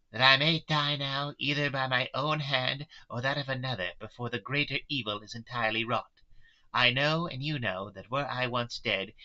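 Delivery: earnest, impassioned Mina. Acted. Mina voiced, facing death.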